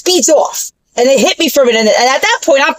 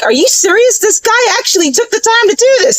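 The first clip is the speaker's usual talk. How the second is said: mock shocked receptionist, raised higher; a receptionist amazed at the rude customer, lightly mimicked in a higher voice; shocked disbelief; 9 semitones higher than usual. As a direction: play his disbelief, pitched up. Receptionist's shocked retold words.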